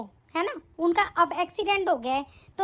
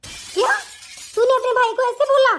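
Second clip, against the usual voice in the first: shocked; 5 semitones higher than usual. Acted. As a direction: react in disbelief to what she said. Mother shocked at daughter's words.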